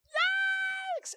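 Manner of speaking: very high, loud squeal; frightened shriek. Acted. The baby dragon fleeing the big dragon, a very high shriek. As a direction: shriek and run. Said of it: "the little dragon went".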